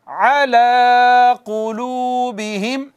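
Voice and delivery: अला कुलूबिहिम intoned slowly with a throaty ain; slow Quranic recitation. Shown as a demonstration. Teacher reciting for students to repeat. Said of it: "पढ़िएगा मेरे बाद".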